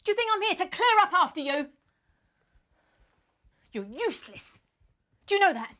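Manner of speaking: sharp, indignant telling-off; fed-up dressing-down; angry scolding. Acted. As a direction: scold with punched, exasperated questions. Scolding over messy room.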